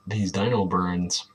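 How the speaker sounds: ordinary talk, nothing marked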